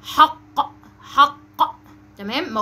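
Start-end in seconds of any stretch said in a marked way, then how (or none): 0.0-1.4 "حق" slowly with a separate popped q at the end; loud model of final q in "حق"; emphatic final qaf. Shown as a demonstration. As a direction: hold "حق", then release a heavy q. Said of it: "بها حرف القاف في الآخر".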